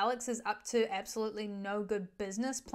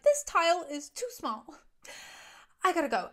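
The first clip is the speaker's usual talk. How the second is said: higher, louder fussing over tile; flustered Janine; 6 semitones higher, 4 dB louder than usual. Retold or imitated. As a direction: make an excuse in a higher rush. Janine fleeing work, upset.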